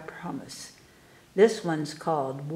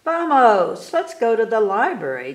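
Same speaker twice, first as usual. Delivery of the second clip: loud, bright call to come along; eager call; 4 semitones higher, 7 dB louder than usual. Acted. Tomas calling his family to the library, loud eager call.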